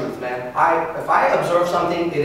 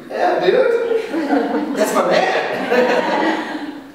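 laughing approval; 7 semitones higher, much slower than usual. Retold or imitated. Girlfriend impressed, laughing.

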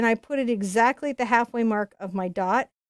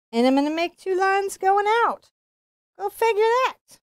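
high, bright and louder; excited; 11 semitones higher, 3 dB louder than usual. Real, not acted. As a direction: lift the voice high with delight. Excited painting success.

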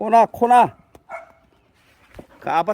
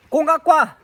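loud, higher call to fetch; loud command; 9 semitones higher, 6 dB louder than usual. Real, not acted. Owner calling his dog to bring the ball loudly.

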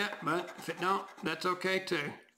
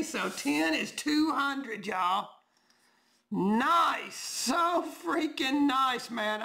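higher, drawn-out delight; excited; 6 semitones higher, much slower than usual. Real, not acted. Marvelling to viewers about his win, slow and delighted. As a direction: stretch the words out, still thrilled.

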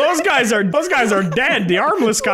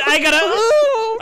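mock crying; 13 semitones higher, 3 dB louder, much slower than usual. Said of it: "Guy said".

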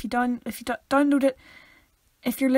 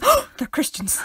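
mock gasp of surprise; 7 semitones higher, 3 dB louder than usual. Imitating visitors' surprise.

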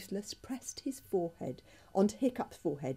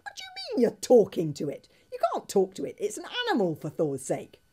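much higher and louder, incredulous outburst; astonished protest; 5 semitones higher, 6 dB louder than usual. A narrator's reading. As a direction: protest in shock, voice high and loud.

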